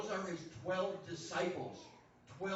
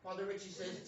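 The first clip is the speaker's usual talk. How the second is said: ordinary talk, nothing marked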